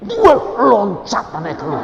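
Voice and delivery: loud, high burst of amazement; excited exclamation. Retold or imitated. Telling the congregation how the baby leapt out, excited exclamation.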